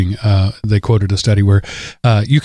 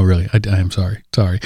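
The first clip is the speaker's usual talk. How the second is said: normal talking voice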